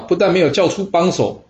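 ordinary delivery, nothing marked

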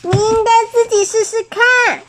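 very high, squealing little-bird voice; high-pitched startled bird; startled cry. A narrator's reading. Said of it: "惊叫地说".

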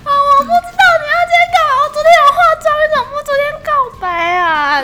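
high, loud, fast whiny complaint; half-sob it like a spoiled protest; mock whine. She whines to her boyfriend that he confessed on her no-makeup day. Whining about having no makeup.

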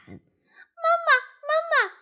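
very high, loud tadpole shouts; calling out. A narrator's reading. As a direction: call after mum in a piping shout. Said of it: "就追上去大喊". (小蝌蚪高声喊妈妈)

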